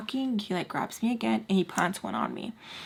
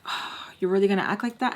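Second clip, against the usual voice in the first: fast breathy whisper, imitating him; mock whisper; faster than usual. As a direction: hiss it like a sulky guy. Guy confronts her at party.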